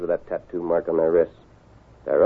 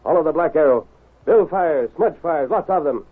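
raised, decisive command; higher-pitched commanding voice; urgent orders; 6 semitones higher than usual. Acted. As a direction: rap out the new plan with urgency. Leader orders action against ambush.

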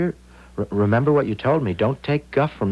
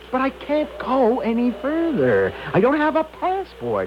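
high, desperate plea; panicked protest; 11 semitones higher than usual. Acted. Traveller without a passport begs off, panicked. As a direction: protest helplessly, pitched way up. Balks at crossing border.

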